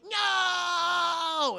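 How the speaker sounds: high, loud villain's cry of no; mock anguished no. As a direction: wail it like a thwarted villain. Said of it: "Red Skull's daughter's like".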